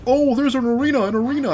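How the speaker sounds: high, put-on villager voice; high mock villager voice; mock NPC hint. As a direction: chirp the clue like a quest NPC. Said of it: "like, clues".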